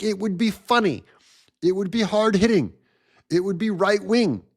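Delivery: ordinary talk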